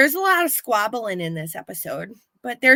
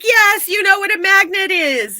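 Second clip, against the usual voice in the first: much higher, louder, exasperated; mock exasperation, pitched way up; 10 semitones higher, 9 dB louder than usual. Joyce losing patience with the Russian, loud and high. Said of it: "And she's like".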